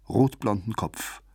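ordinary delivery, nothing marked

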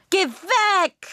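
hoher, lauter Kinderschrei; wütend fortschreien, hoch und laut; schreit. Acted. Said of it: "schreit Juna". (Kind schreit Mama weg, hoch und laut)

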